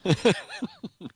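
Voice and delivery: bright high laughter burst; real laugh at the joke; high giggle. Real, not acted. Laughing at his cohost's sunglasses gag, high giggle.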